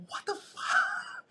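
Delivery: a high, slow, breathy shriek of disbelief; screaming in disbelief. Retold or imitated. To his crewmate after landing the tag, retold with a high, breathy scream. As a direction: shriek it out, stunned and thrilled. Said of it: "Literally at the top of our lungs".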